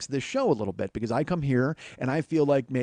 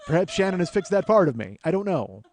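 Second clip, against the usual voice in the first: normal talking voice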